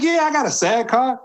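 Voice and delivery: high-pitched mock confidence; eager bluff. Newcomer bluffing to the director, eager high yes.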